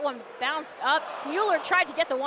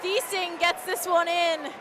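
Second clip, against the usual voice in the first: high, loud shout of goal; excited goal call; 7 semitones higher, 8 dB louder than usual. Real, not acted. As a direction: belt out another goal.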